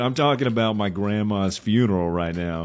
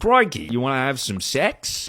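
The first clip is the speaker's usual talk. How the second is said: higher, louder mock Australian accent; 5 semitones higher, 5 dB louder than usual. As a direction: put on an Australian woman's accent, pitched up. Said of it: "with that accent".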